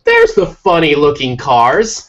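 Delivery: loud, slow, exaggerated American accent; really American accent. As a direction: blurt it out in a hammed-up American voice. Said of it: "say it out loud in, like, a really American accent".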